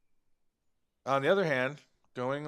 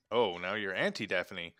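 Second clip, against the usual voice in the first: usual speaking voice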